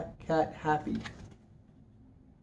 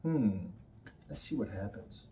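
usual speaking voice

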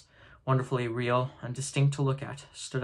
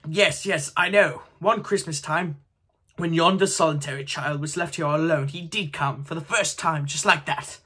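higher, eager and bright; excited; 5 semitones higher, 5 dB louder than usual. A narrator's reading. Scrooge telling the spirit about his old hero, excited. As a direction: tumble out with childlike excitement.

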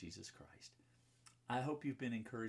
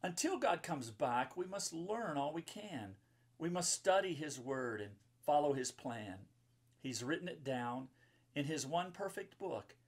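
bright, sing-song rhyming read-aloud; lilting storybook verse; reading to children; 4 dB louder than usual. Acted. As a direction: read the rhyme to a room of kids. Reads rhyming children's book.

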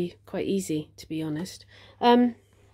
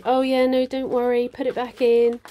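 sweet and nice; 5 semitones higher, 7 dB louder than usual. Pretend. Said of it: "wanted to be all sweet and nice and say".

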